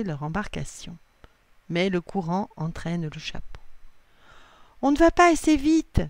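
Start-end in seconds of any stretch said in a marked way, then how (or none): 4.8-6.0 high, loud, urgent; urgent observation. A narrator's reading. Assa sees the hat getting away, urgent observation. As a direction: call it out loudly over the pedalling. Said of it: "constate Assa".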